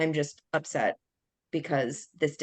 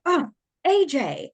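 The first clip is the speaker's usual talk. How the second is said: exasperated high, loud cry of a name; drawn-out, accusing groan at a partner; upset outburst; 12 semitones higher, 6 dB louder, slower than usual. Retold or imitated. Speaker to partner over the burnt cupcakes, upset outburst. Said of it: "I had this big reaction towards him".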